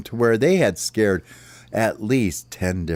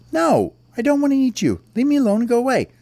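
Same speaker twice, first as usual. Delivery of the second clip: high-pitched, indignant little bird; irritated refusal; 3 dB louder, faster than usual. Acted. Little bird tells worm to leave him alone, irritated. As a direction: brush off the pest, pitch jumping up then falling.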